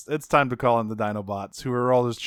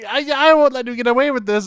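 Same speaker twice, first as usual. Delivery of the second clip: high, loud, fast heroic defiance; defiant Starscream; 11 semitones higher, 7 dB louder, faster than usual. Retold or imitated. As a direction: squeal the vow out in a rush. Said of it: "he's like".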